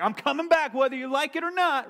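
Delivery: mock entitled passenger, high and loud; high, loud mock-snooty reclining lady; bratty recliner. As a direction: throw it at him like you own the seat. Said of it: "She was like".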